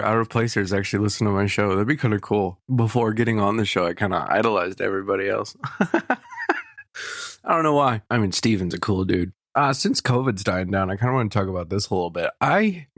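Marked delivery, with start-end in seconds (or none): 5.6-7.4 very high, bursting giggle; real giggle fit that shoots way up; high squealing laugh. Real, not acted. Laughing at own confession.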